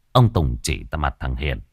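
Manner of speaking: ordinary delivery, nothing marked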